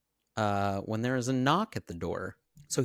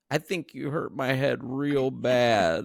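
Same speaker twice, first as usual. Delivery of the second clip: pleading injured husband; 6 semitones higher, 5 dB louder than usual. Retold or imitated. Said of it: "he says".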